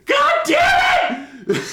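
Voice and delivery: very high laughing groan, dragged out slowly; laughing frustration. Real, not acted. Annoyed reader at the plot, a laughing frustrated cry. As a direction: cry out in exasperation then laugh.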